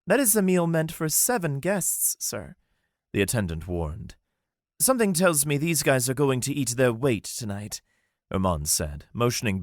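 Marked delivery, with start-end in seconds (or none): 0.1-2.5 higher, polite caution; lightly voiced attendant warning. Attendant warning Armand about the big meal. 4.8-7.8 higher, courteous gentleman voice; polite elf Armand. Acted. Armand politely asking the attendant for a table.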